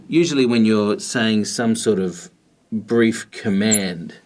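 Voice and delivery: ordinary talk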